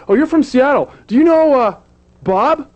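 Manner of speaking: high, loud excited woman asking about Bob; a lady from the party lighting up about Seattle. Retold or imitated. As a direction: play her bright and eager, pitched well up. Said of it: "And then she says".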